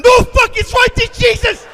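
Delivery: high, loud terrified scream; panicked scream at the nearby ground; panicked yell. Retold or imitated. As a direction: shriek as the ground rushes up.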